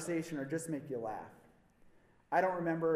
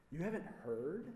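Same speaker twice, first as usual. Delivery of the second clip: lowered voice, secretive; hushed gossip; 6 dB quieter than usual. Retold or imitated. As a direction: drop the voice like sharing gossip at the market. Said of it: "they're all whispers".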